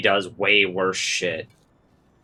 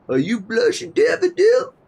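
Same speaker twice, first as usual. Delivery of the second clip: squeaky, much higher teasing voice; mocking Punisher tease; 5 dB louder than usual. Punisher teasing Spider-Man about blushing, silly high mock voice. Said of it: "Punisher's like".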